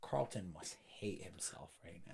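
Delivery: soft unvoiced whisper. Real, not acted. Worried about the roommate, a soft whisper. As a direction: whisper so the roommate won't hear. Neighbour hearing the noise.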